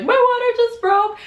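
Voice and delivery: high, squeaky announcement; excited announcement. Retold or imitated. Wheeled in by the orderly, announcing it high and giddy.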